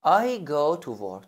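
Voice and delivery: "I go to work" drawn out very slowly; slow model sentence. Shown as a demonstration. Teacher to student, a slow model sentence. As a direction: spell it out slowly for beginners.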